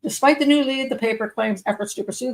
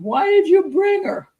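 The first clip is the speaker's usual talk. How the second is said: put-on high, slow drunken yell; drawn-out furious shout at the wife; mock yell; 9 semitones higher, 5 dB louder, slower than usual. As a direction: shout it at his wife, slowly. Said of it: "she had heard the man yelling".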